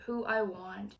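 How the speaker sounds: ordinary talk, nothing marked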